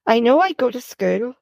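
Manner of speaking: plain reading voice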